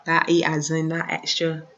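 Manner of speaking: normal talking voice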